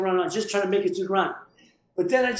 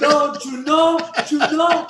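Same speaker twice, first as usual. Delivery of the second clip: Tony Montana; 6 semitones higher, 5 dB louder, much slower than usual. Retold or imitated. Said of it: "I gotta Tony Montana".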